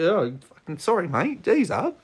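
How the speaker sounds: a player cowed when a fierce coach yells at him; cowed apology. Retold or imitated. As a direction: shrink back like a scolded cat. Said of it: "like a cat".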